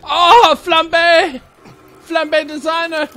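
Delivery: high-pitched loud yell; piercing high exclamation; really loud outburst. Real, not acted. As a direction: cry out suddenly, way up high. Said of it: "that was probably really loud".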